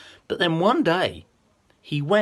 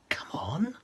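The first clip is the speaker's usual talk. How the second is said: whisper; 7 semitones higher, 7 dB quieter than usual. Acted. Coaxing shy puppet.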